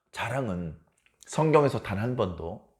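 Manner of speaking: ordinary delivery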